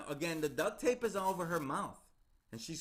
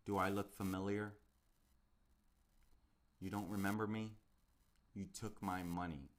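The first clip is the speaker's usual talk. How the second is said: low menacing killer; 7 semitones lower, 4 dB quieter than usual. Retold or imitated. Killer reveals himself to victim.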